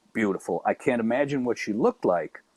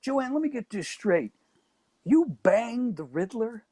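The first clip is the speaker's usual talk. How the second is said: incredulous; 11 semitones higher than usual. Retold or imitated. Incredulous question retold.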